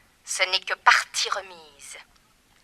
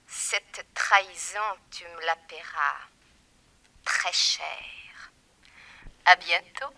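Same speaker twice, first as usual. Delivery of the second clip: low menacing whisper; 4 semitones lower, slower than usual. Acted. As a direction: let it sink to a whispered vow of revenge. Villainess vows revenge.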